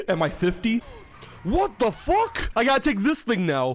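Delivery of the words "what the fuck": stunned disbelief. Real, not acted. Repeated, drawn out and higher.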